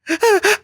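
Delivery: high, whimpering laugh noises; imitated helpless laughing. Swimmer who couldn't stop laughing, high helpless whimpers. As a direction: squeak out breathless, sob-like bursts of laughter. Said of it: "releasing noises 'cause I couldn't stop laughing".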